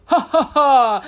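booming jolly grandpa laugh; loud, high hearty laugh; hearty old man laugh. Acted. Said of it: "突然窜出来一位老爷爷说".

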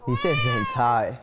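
mocking friend. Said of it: "他就会说".

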